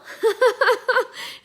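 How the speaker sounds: high, loose giggling burst; amused laugh. Real, not acted. Host reading a viewer's comment, amused high laugh. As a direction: break into a high cackle of amusement.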